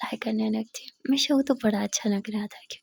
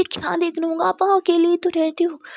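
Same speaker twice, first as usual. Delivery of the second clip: indignant protest; 7 semitones higher, 5 dB louder than usual. Acted. Jia protests Misha leaving.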